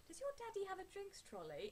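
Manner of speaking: a high mock upper-class British accent; posh British voice. British voice quoted to friend while fangirling. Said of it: "you're doing the voice again".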